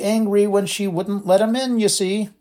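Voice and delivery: high, sly, relishing tone; sly poltergeist. A narrator's reading. Poltergeist gloating to Dumbledore, sly and high. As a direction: drop the news with relish. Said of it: "air of one cradling a large bombshell".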